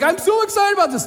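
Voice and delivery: sharing a promotion, high and thrilled; mock excitement. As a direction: squeal it out like great news. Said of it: "you're like".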